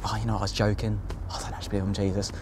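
quick, quiet climb-down to the nurse; sheepish backpedal. Retold or imitated. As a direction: mumble it fast, hoping to get out of it. Backpedalling before hospital.

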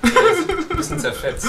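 high, laughing words; lachend. Real, not acted. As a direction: squeeze the words out through laughter. Joking about the headless ghost. (unter Lachen gesprochen)

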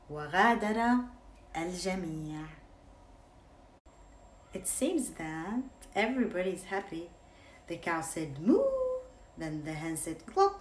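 ordinary delivery, nothing marked

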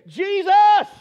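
loud, high desperate cry of the name. Acted. Blind beggar cries out to Jesus from the roadside. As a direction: call out the name with all your need. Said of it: "he began to cry out and he began to say".

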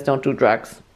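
low, a hint of a stern father; stern dad voice. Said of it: "if he says".

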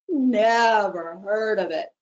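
slow, drawn-out crane voice; lazy crane drawl. Acted. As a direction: stretch every word like a lazy crane. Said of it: "drawled one of the cranes".

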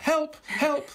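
very high little yelps imitating a toddler; mock child's shout. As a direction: squeal it as a playful imitation, not at full volume. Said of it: "shouted at the top of his voice".

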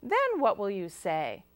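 ordinary delivery

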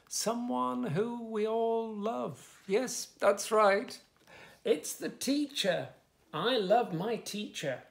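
put-on storyteller teasing a surprise for children; bright storytime build-up. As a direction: make the children eager for the answer. Excited build-up to the title.

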